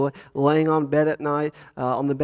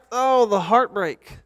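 higher, slow mock lament over the empty seat; mock heartbreak; 7 semitones higher, 4 dB louder, slower than usual. Pastor to congregation over the absent winner, mock heartbreak. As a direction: ham up the disappointment. Prize winner has left.

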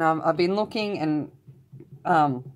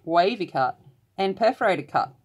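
ordinary delivery

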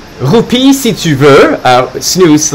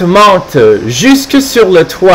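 usual speaking voice

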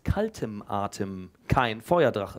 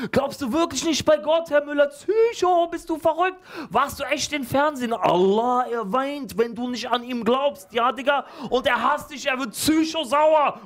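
high, loud schoolkids hurling comments; loudly yelling kids; 12 semitones higher than usual. Acted. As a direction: bellow them out like rowdy pupils across the room. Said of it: "die Kommentare lauthals durch den Raum zu schmettern".

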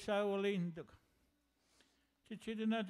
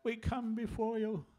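praying. Acted. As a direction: pour out the grief in prayer.

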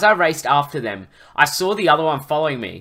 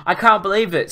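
high, contained narrator's scream; mild excited scream, raised pitch; 6 semitones higher, 4 dB louder than usual. A narrator's reading. Luke celebrates the enemy retreat, mildly screamed. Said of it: "screamed Luke".

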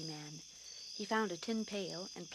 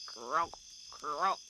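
croaking frog; 2 semitones lower, 4 dB louder than usual. A narrator's reading. Narrator croaking like the bullfrog. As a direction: croak like a bullfrog by the rain pipe.

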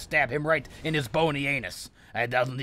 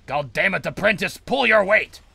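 angry command building louder; exasperated shout; 6 semitones higher, 8 dB louder than usual. Pretend. Sorcerer scolding his apprentice in the game, exasperated shout. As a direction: shout higher and louder, ending on a bellow.

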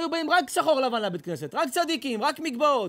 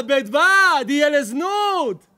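slow, loud Talmud quote shouted; preaching shout; 7 dB louder, slower than usual. Real, not acted. Rabbi to congregation, loud slow preaching shout. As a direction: thunder the Gemara line to the congregation. Rabbi cites Gemara at full force.

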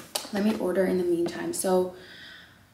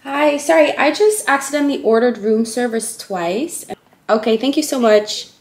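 higher, louder customer-service politeness; polite phone voice; 4 semitones higher, 10 dB louder than usual. Real, not acted. Calling hotel staff about a double order, polite phone voice. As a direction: sound bright and apologetic on the phone.

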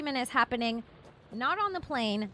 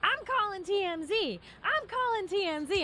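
high-pitched repeated threat, imitated; mock yelling; 8 semitones higher than usual. Passenger threatens to call T M Z, mock yelling. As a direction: mimic her yelling, voice pitched up. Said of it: "then she starts yelling".

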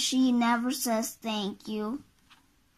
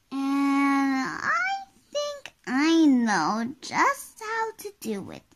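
Little Miss Magic. Acted. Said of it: "she replied".

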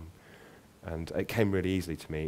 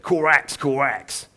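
loud, higher raven cry with a Cockney twang; raven's London croak; 6 semitones higher, 6 dB louder, much slower than usual. Acted. Raven introducing itself to Aubrey, loud Cockney caw. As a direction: squawk it like a big London raven. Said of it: "loud, throaty voice and an accent".

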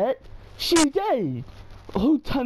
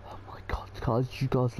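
low, unvoiced whisper to viewers; 12 semitones lower, 9 dB quieter, faster than usual. Real, not acted. Hushed aside in scary game.

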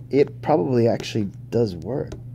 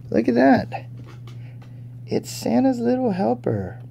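much higher, thrilled squeal of recognition; excited delight; 13 semitones higher than usual. Real, not acted. Collector spotting the dog figure, higher with excitement.

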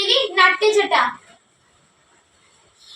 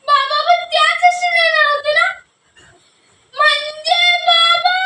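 frightened; 6 semitones higher than usual. Acted. Fears father was on bus.

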